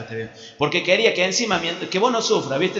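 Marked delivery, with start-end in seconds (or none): none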